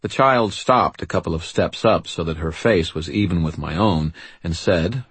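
usual speaking voice

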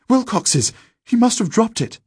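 high, loud excited answer; high-pitched panicked exclamation; urgent boy's cry. Acted. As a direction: call it out urgently, voice pitched right up. Frightened boy explains the found whistle.

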